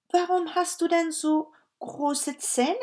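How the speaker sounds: put-on amazed little girl asking. Girl voiced in story.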